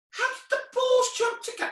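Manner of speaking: very high, incredulous squeal; hammed-up bafflement; mock amazement. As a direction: squeak it in put-on shock. Balls join, feigned shock.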